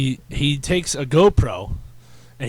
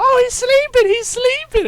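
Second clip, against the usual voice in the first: very high, loud gleeful yelling; imitated falsetto heckling; hysterical onlooker; 6 dB louder than usual. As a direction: squeal it like a crowing hype man. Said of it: "he was running around laughing".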